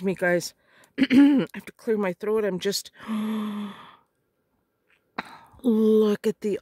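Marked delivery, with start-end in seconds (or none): 3.0-3.7 soft awed gasp; a small breath of wonder. Real, not acted. Crafter spotting the olive green lace, gasping softly.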